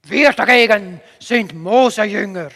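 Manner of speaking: loud, slow, weighty declaration; angry shout. A narrator's reading. Opponents set themselves apart from Jesus' follower, loud and angry. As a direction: proclaim it forcefully, stretching the words. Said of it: "Dann wurden sie wütend".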